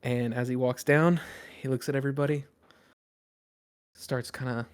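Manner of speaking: ordinary delivery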